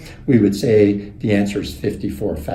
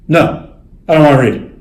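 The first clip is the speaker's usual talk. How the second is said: loud refusal; 6 semitones higher, 9 dB louder, faster than usual. Retold or imitated. Executive refusing to read card.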